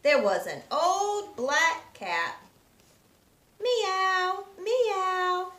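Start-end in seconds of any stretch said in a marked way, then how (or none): 3.6-5.5 higher meowing cat voice. Acted. Teacher meowing as the barn cat for children, higher. Old cat's meow.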